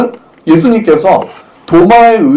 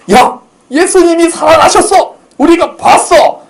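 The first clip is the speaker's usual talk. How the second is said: excited news; 12 semitones higher than usual. Acted. Disciples excitedly telling Thomas.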